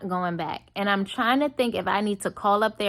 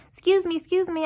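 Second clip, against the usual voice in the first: waitress calling out; 10 semitones higher, faster than usual. Retold or imitated. Waitress chasing her down outside.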